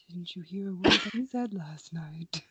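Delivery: mock calm whisper. Friend voicing the calm figure, soft and low. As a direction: hush it like a patient spirit. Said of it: "She's like".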